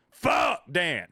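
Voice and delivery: loud, high-pitched hammed-up curse; mock angry outburst. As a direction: play a store worker shouting a grudge. Climax of acted dollar store rant.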